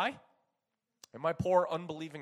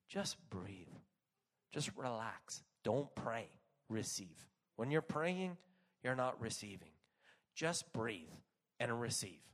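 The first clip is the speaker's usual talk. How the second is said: soft, low, unhurried reassurance; quiet, lowered voice of a calming minister; calm instruction; 6 semitones lower, 7 dB quieter than usual. Retold or imitated. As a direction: settle someone down gently, pausing between phrases. Minister calms her as he prays.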